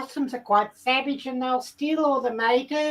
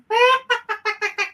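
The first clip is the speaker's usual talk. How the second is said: excited cackling laugh; 8 semitones higher, 4 dB louder than usual. Acted. Excited chicken character laughing.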